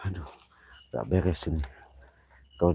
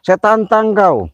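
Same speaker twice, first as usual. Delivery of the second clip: much higher and louder, slow; daring the spirit to appear; slow shouted challenge; 14 semitones higher, 6 dB louder, much slower than usual. Real, not acted. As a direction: drag out the dare at full voice.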